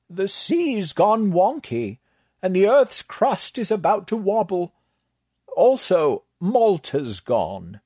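lightly voiced high, slow scholar, deadpan; the librarian. The librarian listing disasters to the cultists, slow and dry. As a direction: list the disasters drily.

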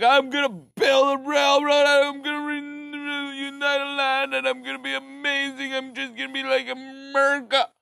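high, loud, hammed-up visionary shouting his plans; mock grandiose madman. Mocking Canada's railroad builder, high hammed-up shout. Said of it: "one madman just being like".

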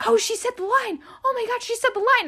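high, rushed, thrilled reaction; excited gasp. Retold or imitated. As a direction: gush like you just caught the big moment. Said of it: "I was just like".

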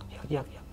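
very faint whispered chirps; barely voiced chick peeps; imitated chick cry. The creature seen circling from the tent, faint imitated chick cry. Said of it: "kayak suara anak ayam".

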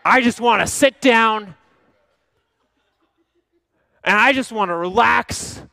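exasperated husband half-shouting, laughing; mock exasperated outburst. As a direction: play the grumpy husband for laughs. Pastor acts out irritated husband.

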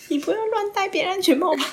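high-pitched laughing burst; laughing at the date's helmet. Retold or imitated. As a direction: crack up mid-thought. Inner thought, then bursts laughing.

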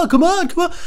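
loud, very high acted cry; high mock yell. Acted shouted retold outburst.

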